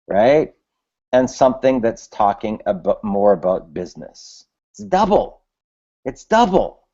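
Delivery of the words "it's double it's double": excited. Real, not acted. Excited about test results.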